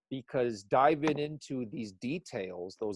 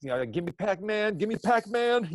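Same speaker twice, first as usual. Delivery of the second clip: urging like a Wheel of Fortune contestant; eager urging; 5 semitones higher than usual. Retold or imitated. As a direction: beg them to say it, game-show style. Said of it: "I'm like, in my head".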